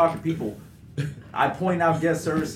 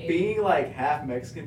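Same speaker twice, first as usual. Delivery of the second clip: ordinary talk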